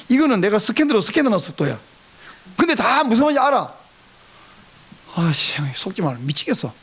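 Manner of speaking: usual speaking voice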